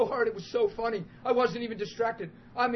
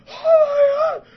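very high, loud cry of overwhelmed shock; imitated shocked scream; 15 dB louder, much slower than usual. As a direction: scream it like a man stunned by a miracle. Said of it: "like, shocked, traumatized at the reality".

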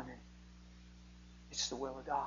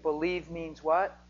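ordinary delivery

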